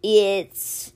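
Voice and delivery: slow, drawn-out creature voice; cheetah character. A narrator's reading. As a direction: stretch the word out like a big cat.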